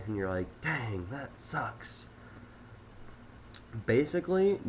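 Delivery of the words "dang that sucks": slow, soft, deflated sigh; upset sigh. Shown as a demonstration. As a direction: let it out like bad news. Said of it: "when you're upset and you sigh".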